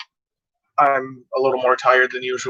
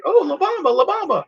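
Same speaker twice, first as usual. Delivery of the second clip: mocking fans; 13 semitones higher than usual. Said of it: "everyone's like".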